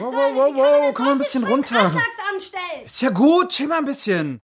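mock alarm while talking someone down; hammed-up calming. Beschwichtigt einen Aufgebrachten. (übertriebenes Beschwichtigen, hoch und laut)

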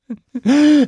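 high, loud relieved laugh. Acted. Dragon relieved.

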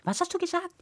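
high, soft questioning voice; puzzled child. Acted. Child hears a strange voice, puzzled. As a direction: ask softly in a high child's voice.

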